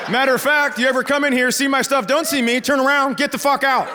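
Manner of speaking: high-pitched, mounting dad tirade; comic outraged telling-off; indignant homeowner rant. Retold or imitated. Homeowner scolds daughter's boyfriend.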